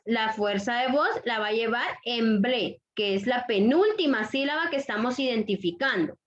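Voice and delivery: ordinary talk, nothing marked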